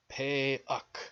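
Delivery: "Pe Uk" in two separate, deliberate beats; "Pe Uk", each part distinct and firm; careful sounding-out. Shown as a demonstration. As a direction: sound out "Pe Uk" syllable by syllable. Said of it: "phonetically sound out all these numbers".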